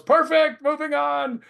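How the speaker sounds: high, loud yell to the crew. Retold or imitated. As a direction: shout it out to the whole set. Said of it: "he yells".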